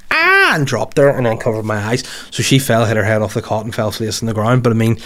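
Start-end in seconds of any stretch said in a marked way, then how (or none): 0.1-0.6 sudden high yelp of pain, imitated; retold yelp. Retelling the baby kneeing his eye, high pained yelp. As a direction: yelp as if your eye just got kneed. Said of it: "I just went".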